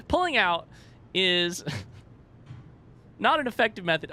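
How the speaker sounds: high-pitched, laughing through the words; real laughing. Real, not acted. Gamer cracks up at an accidental innuendo, laughing. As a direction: fight the giggles while finishing the joke.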